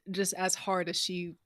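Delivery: normal talking voice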